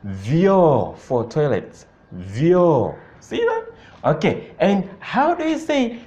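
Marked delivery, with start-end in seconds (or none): 0.0-3.0 "Vyoo" slow, low, one syllable vyo; vyoo said once. Shown as a demonstration. Teacher showing the toilet word, slow and low. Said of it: "Here you'll say it once".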